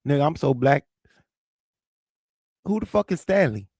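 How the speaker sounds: ordinary delivery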